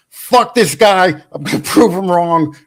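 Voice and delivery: impersonated indignant boast; mock defiant outburst. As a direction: blurt it out like a fired-up buddy. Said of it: "Jay'll be like".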